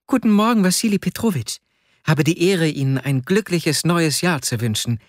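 put-on high-pitched boy's formal greeting; high child voice, politely formal; young boy's voice. A narrator's reading. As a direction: recite good wishes like a polite little boy. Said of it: "etwa zehnjähriger Bengel mit schwarzen Locken".

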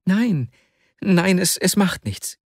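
high-pitched, faltering denial; anxious friend, voice lifted high; troubled Vasja. A narrator's reading. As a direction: brush off the worry, stumbling slightly. Vasja brushes off his friend's worry.

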